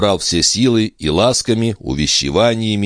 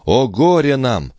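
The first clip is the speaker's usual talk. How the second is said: lament; 7 semitones higher, slower than usual. Acted. Lament after child stolen.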